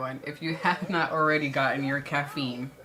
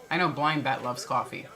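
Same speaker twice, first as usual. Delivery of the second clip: ordinary talk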